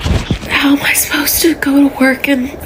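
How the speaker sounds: hushed, breathy whisper; barely voiced. Real, not acted. Whispered lines.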